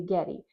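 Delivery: "getty" with the first syllable dropped; child's getty. Shown as a demonstration. Therapist showing a child's shortened "getty". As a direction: say "getty" like a toddler. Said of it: "they say getty".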